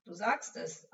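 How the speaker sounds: plain reading voice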